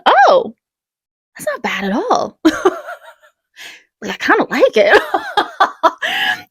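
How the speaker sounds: high, laughing relief; relieved laughter. Retold or imitated. First-timer realizing tattoo barely hurts, high laughing relief. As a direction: giggle with surprised relief.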